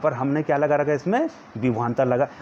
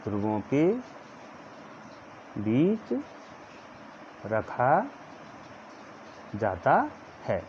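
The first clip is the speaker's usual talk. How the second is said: one word at a time with long gaps; word-by-word repeat; 9 dB quieter, slower than usual. Shown as a demonstration. Teacher repeating the phrase word by word for note-taking. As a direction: repeat each word separately for copying. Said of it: "मैं धीरे धीरे बोल रहा हूँ".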